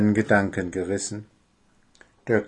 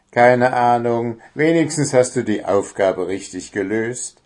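plain reading voice

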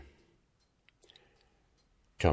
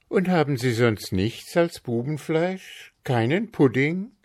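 put-on hopeful boy; 7 semitones higher than usual. A narrator's reading. Tommy asks.

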